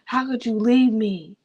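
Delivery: slow, hammed-up movie heartbreak; mock-dramatic, drawn-out plea; movie-style lament. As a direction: plead slowly like an abandoned movie character. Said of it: "when you watch movies, you're just like".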